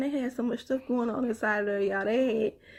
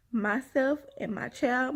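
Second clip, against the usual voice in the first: normal talking voice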